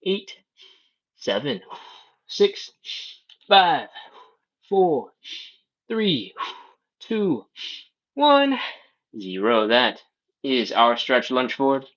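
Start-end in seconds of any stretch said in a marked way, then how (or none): 0.0-8.6 strained countdown. Real, not acted. Instructor counting out the last seconds of a hold, straining. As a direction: pitch climbing higher on each number, straining.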